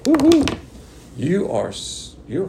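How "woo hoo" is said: excited whoop. Real, not acted. Excited cheer mid-sermon.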